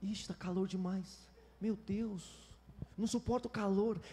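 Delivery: soft mock moaning; whiny grumbling. Preacher mimicking a believer who can't stand heat, whining softly. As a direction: sigh out the complaint, hushed and put-upon. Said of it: "você fica choramingando".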